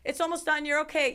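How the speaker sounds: high, loud mimicked chirp; breezy reassurance. Staff to a panicking patient, breezy mock reassurance.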